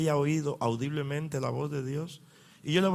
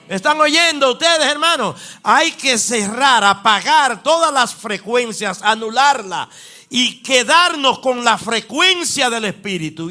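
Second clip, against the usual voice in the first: loud, high, fervent preaching; preacher calling out to the congregation with rising force; full-force preaching; 6 semitones higher, 8 dB louder than usual. Acted. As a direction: drive it home to the congregation.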